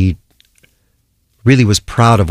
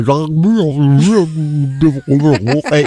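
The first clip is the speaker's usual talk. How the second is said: higher-pitched wordless mumble of a garbled tannoy; garbled airport announcement; 7 semitones higher than usual. Acted. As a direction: blur the announcement into echoing gibberish. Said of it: "making an announcement in an airport".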